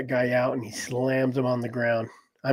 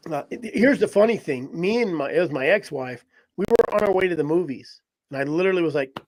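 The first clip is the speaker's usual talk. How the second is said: ordinary talk, nothing marked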